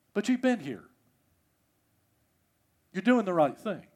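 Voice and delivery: calm the angry son tenderly; gentle father. Acted.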